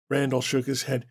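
normal talking voice

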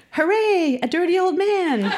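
high, gleeful cheer; high mock cheer with a laugh in it; mock-excited teacher. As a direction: read it out as a delighted, high-pitched exclamation. Reads out teacher's red-pen comment.

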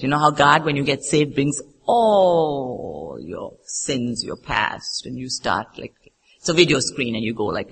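usual speaking voice

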